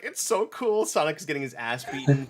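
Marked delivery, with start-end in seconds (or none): 0.0-0.9 higher, ironic cheer while cleaning puke; higher ironic enthusiasm; mock excited. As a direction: fake delight in a high voice. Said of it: "I'm like".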